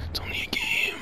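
thin, breathy whisper; self-soothing whisper. Real, not acted. Gamer talking self down from rage, whispered. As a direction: whisper it like a calming mantra.

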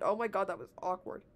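usual speaking voice

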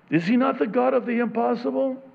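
full-force sermon voice; fervent preaching. Acted. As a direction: drive it home to the congregation. Preaching climax.

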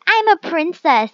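mock snooty claim; snooty princess. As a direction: show off as a spoiled princess. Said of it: "She's like".

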